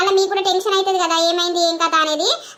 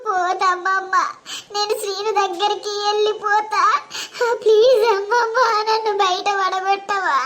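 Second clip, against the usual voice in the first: weep and beg her to help you escape; sobbing plea; 4 semitones higher than usual. Acted. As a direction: bride crying to grandmother to let her go. Bride keeps pleading.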